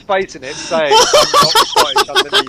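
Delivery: laughing through it. Real, not acted. Laughing after friend's impression.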